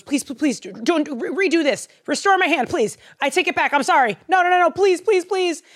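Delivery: high, loud, stammering begging; J B freaking out. Acted. King begs to have his hand restored, panicked pleading. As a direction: plead frantically, pitch climbing to the last please. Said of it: "J B starts freaking out".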